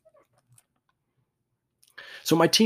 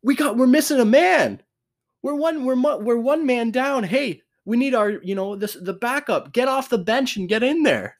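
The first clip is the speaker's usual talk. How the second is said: hammed-up coach barking orders from the sideline; frantic coach; 11 semitones higher than usual. Opposing coach realizing he's a man down, mock frantic. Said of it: "their coach is going".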